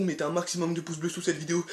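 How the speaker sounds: ordinary delivery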